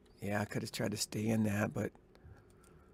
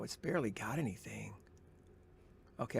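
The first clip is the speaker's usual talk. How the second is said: ordinary delivery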